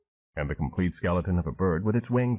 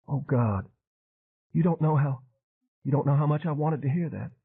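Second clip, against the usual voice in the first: higher, breaking with relief; tearful relief; 7 semitones higher than usual. Acted. Arden hearing the girl lives, tearful. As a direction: overcome with emotion.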